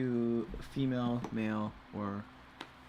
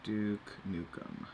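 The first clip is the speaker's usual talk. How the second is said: Duke Nukem impression; 4 dB quieter, much slower than usual. Shown as a demonstration. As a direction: drawl the name like a tough guy. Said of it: "Let's see if I can do it".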